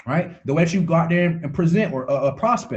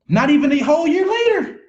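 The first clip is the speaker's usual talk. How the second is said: "Not even a whole year later" called out much higher and louder, "later" falling; excited exclaim; 10 semitones higher, 3 dB louder than usual. Real, not acted. Coach bragging to his team about the new car, excited shout. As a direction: shout the brag triumphantly. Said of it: "repeats the line".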